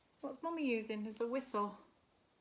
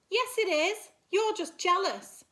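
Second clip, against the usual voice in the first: high, loud taunting reply; winning team shouting back, teasing; mocking shout; 9 semitones higher, 11 dB louder than usual. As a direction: jeer back at them.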